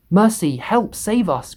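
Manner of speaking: high, loud terrified plea; crying out. A narrator's reading. Ladybird crying for help against the Cloud Man, high and terrified. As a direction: beg for rescue in panic. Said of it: "cried the ladybird".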